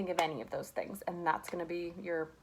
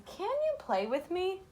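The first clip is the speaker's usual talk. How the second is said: higher, rising like a question; questioning read; 11 semitones higher, slower than usual. Shown as a demonstration. Teacher rereading the sentence to students as a question. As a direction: lift the voice to show it asks something. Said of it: "let's listen to that sentence again".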